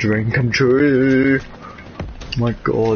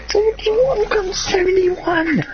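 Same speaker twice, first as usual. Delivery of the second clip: excited. Real, not acted. Overjoyed at reaching 71 subscribers.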